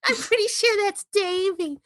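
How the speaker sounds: high put-on accent, slipping; a stray put-on accent for Gloria; wrong put-on accent. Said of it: "I'm doing the wrong accent now".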